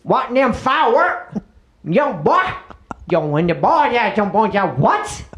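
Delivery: cartoon riverside mumbo-jumbo; hammed-up local character. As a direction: babble like a barely intelligible local. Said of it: "I don't understand what you're saying".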